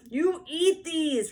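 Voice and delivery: high, loud, slow exasperated yell; loud little-girl yell. A narrator's reading. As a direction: yell it at the crybaby. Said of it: "Ramona yelled".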